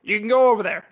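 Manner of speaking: lightly voiced game-trailer pitchman; Todd Howard pitch. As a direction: hype up the open world, lightly. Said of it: "That was Skyrim".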